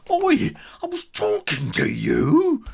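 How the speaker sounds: hammed-up bully shout; angry bully yell. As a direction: bark it across the playground. Bully yells.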